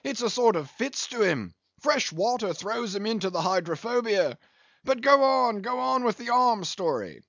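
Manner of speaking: very high, excited teasing captain; jovial interrupting captain. A narrator's reading.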